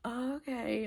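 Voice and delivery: high, drawn-out groggy reply; a hint of a half-asleep mumbler; lightly voiced sleepy roommate. Roommate half asleep answering the door, lightly imitated grogginess. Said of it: "who was, like, half asleep".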